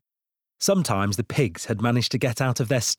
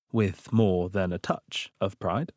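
ordinary delivery

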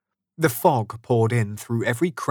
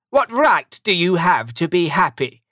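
higher, louder scornful question; grumpy challenge; 7 semitones higher, 6 dB louder than usual. A narrator's reading. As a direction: demand it like a cross old miser. Scrooge challenges cheerful nephew.

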